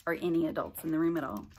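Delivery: ordinary delivery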